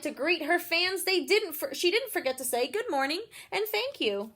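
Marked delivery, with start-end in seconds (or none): none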